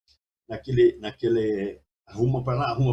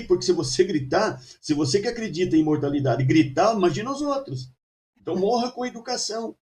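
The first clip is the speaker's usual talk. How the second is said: higher, half-joking stern advice; serious with a joke behind; 7 semitones higher than usual. Retold or imitated. Emmanuel teasing Chico to die politely, mock stern. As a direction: scold him with a teasing undertone. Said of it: "falando sério, mas um fundo de brincadeira".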